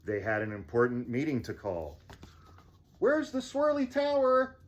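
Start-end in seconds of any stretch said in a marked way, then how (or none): 3.0-4.5 much higher put-on voice; high character voice. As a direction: squeak it out in a high voice.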